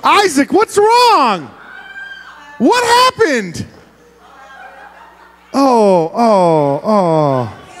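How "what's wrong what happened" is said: high, slow what happened; concerned surprise. Real, not acted. Child upset after empty box.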